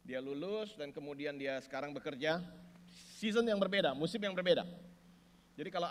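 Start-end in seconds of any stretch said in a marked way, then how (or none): 3.2-4.6 loud, driving preacher's proclamation; preaching at full force. Acted. Pastor to the congregation, preaching at full force. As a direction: press each phrase home to the congregation.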